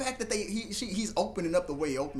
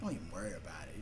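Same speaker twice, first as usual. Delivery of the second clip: much lower, soft reassurance; 10 semitones lower, 10 dB quieter, faster than usual. Retold or imitated. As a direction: soothe him gently.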